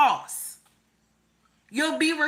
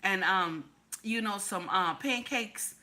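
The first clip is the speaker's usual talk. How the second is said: much softer, lazy little ask; husband's request; 3 semitones lower, 7 dB quieter than usual. Retold or imitated. Husband asking for breakfast, softly and lazily. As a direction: murmur it like a sleepy husband. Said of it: "He say".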